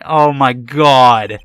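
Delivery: shocked outcry. Real, not acted. Shocked by courtroom twist.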